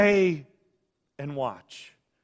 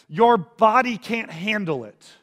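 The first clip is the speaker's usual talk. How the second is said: ordinary talk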